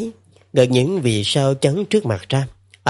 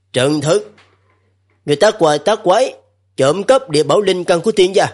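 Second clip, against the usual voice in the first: stern god voice; 4 semitones higher than usual. Acted. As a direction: scold him from on high. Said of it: "giọng nói trầm trầm, chấn động".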